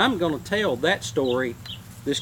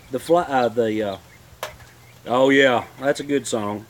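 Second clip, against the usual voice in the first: ordinary talk, nothing marked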